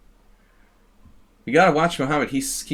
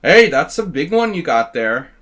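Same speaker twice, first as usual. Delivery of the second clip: put-on Canadian accent; 3 semitones higher, 8 dB louder than usual. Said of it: "I practiced by playing this".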